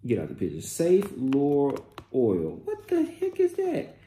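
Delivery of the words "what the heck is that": high incredulous question; baffled high disbelief. Real, not acted. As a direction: pitch shooting way up in confusion. Baffled by ingredient.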